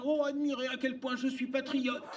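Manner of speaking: high, mock old lady boasting. Comedian at a debate, mocking an old lady in flag underwear with a high voice. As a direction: play a prim old lady showing off. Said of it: "une vieille dame portant un string en drapeau et disant".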